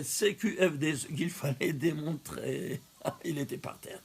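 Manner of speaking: usual speaking voice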